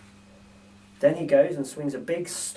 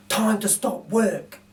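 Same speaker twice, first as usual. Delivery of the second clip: slow, higher burglar voice; drawn out like a tired thief; lightly voiced Bill; 5 semitones higher, much slower than usual. Narrator voices Bill ending his night's work, slowly. Bill's voice in read story.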